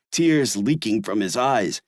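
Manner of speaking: usual speaking voice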